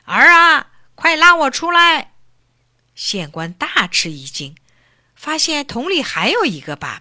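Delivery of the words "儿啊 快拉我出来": put-on old man shouting up from the barrel; calling out. A narrator's reading. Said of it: "桶里又有一个声音叫道". (老头子在桶里大声呼救)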